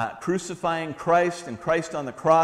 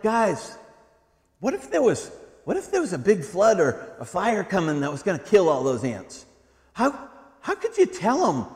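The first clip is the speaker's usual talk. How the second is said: animated father question; 7 semitones higher, 4 dB louder than usual. Retold or imitated. Father asking kids.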